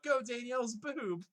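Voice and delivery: high, loud hammed-up Pokemon trainer shout; over-the-top trainer calling out his Pokemon; mock trainer battle cry. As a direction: yell it like a kid commanding his Pokemon. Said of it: "I'm just thinking about them being thrown into battle".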